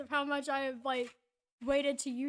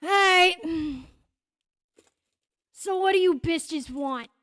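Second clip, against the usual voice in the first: brash shopkeeper; 2 semitones higher, 8 dB louder, slower than usual. Acted. Shopkeeper turns to the group.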